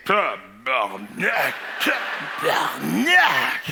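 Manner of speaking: slow low tabarnak twice; drawn-out curses. Retold or imitated. Workers in the store swearing slowly. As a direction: let the swears drag out.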